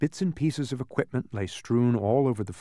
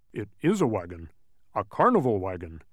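Tock; 3 semitones lower than usual. A narrator's reading. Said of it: "seconded Tock".

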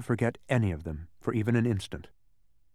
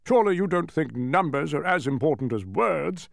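mildly raised, indignant character call; distant shout; 3 semitones higher than usual. A narrator's reading. King argues with mathematician, mildly shouted. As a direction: call it as if from far away. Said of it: "heard Azaz shout from the distance".

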